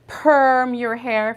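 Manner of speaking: perm said slow and loud, stretched out; stressed perm. Shown as a demonstration. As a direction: lean on perm, slow and clear. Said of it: "teacher stresses key verb".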